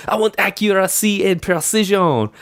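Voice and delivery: put-on high, drawn-up French-accented command; French officer accent. Host quotes The Patriot's French officer, in a high French accent. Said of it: "Mel Gibson's Patriot".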